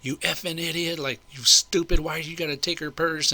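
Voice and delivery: retold pissed-off rant. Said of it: "So I'm kinda pissed".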